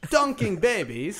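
slow, exaggerated Australian accent. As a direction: read it like a hammy Aussie, swooping high. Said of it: "Just, just read in English".

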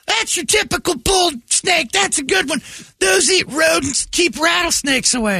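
very high, slow, booming enthusiast; Brady's voice. Retold or imitated. Brady answering the snake photo, very high excited shouting. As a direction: shout it out like an overjoyed snake nerd.

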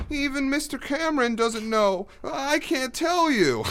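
put-on very high, slow whining kid voice; janitor kid impression. Stagehand mimicking the janitor kid refusing to tell, squealing. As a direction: drag it out in a mocking squeal. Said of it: "that's exactly what he sounds like".

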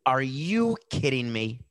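ordinary talk, nothing marked

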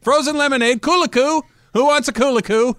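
loud, high ballpark vendor shout; peanut vendor. Pretend. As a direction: bellow it like a stadium hawker. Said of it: "it's a peanut vendor".

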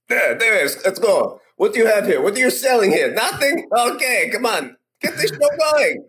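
put-on a pushy old-school Russian salesman; Russian accent. Puppet voice of an old marketer, hammed-up Russian accent. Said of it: "I put him on sometimes as that, that brings forth my dad".

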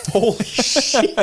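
high, slow laughing astonishment; amazed, laughing. Real, not acted. Host hearing the salary confirmed, amazed and laughing. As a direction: stretch it out high through laughter.

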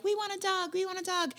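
put-on high, soft pleading child voice; whiny kid. Imitating husband and daughter begging for a dog, whiny. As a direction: beg like a child. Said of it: "you and Alice were like".

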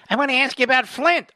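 high, loud mocking reporter; lightly mock an eager reporter.

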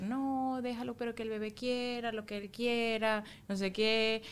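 soft, easygoing mock indulgence; imitated permissive parent. Mother imitating lenient father letting baby do anything, indulgent. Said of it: "Él es súper".

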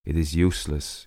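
plain reading voice

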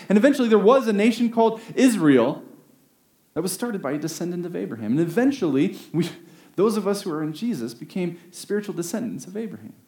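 normal talking voice

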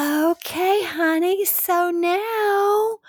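high, very slow and drawn out; slow talker. Retold or imitated. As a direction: drag out each word sleepily. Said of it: "I talk so slow".

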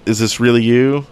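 ordinary delivery

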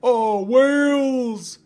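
very high, loud, slow drawn-out scream; screaming in despair while leaping off the ship. Acted. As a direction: wail it as you fall. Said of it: "he screamed".

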